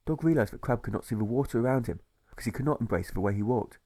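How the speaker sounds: ordinary delivery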